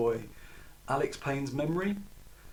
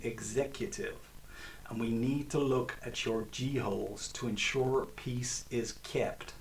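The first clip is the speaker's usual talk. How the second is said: lightly voiced Ivy League American agent; a hint of a clipped-free American agent accent, unhurried; a hint of a menacing American agent's Ivy League accent. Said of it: "complete with Ivy League American accent".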